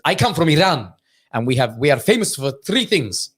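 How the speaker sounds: put-on Iranian-accented stand-up delivery. Comedian friend introduces Iran to audience, Iranian accent. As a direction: announce it proudly in an Iranian accent. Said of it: "does the whole Iranian thing".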